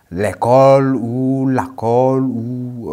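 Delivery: "l'école ou la colle" said slowly, each form spelled out clearly, with "la colle" as a variant of "l'école"; regional pronunciation demo. Shown as a demonstration. Radio teacher showing listeners the regional "la colle", slowly and clearly. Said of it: "dans certains contrées où on dit".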